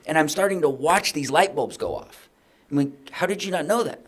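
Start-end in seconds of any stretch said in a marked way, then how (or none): 3.1-4.0 higher incredulous question; disbelieving and exasperated; irritated boss in disbelief; frustrated. Retold or imitated. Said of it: "I'm frustrated".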